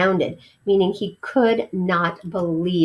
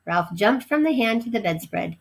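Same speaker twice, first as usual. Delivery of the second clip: ordinary delivery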